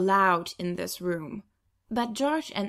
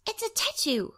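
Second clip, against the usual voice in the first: put-on much higher, proud little girl; little girl's voice; 13 semitones higher than usual. Little sister to big sister, proud childlike reveal. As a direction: announce it like a proud small child.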